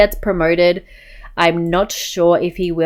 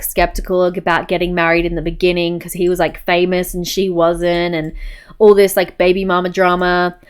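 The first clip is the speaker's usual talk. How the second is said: ordinary talk, nothing marked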